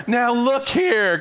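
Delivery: a fed-up stranger scolding the repeat caller; heated reply. Retold or imitated. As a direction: bite out each word like a fed-up man. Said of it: "came the heated reply".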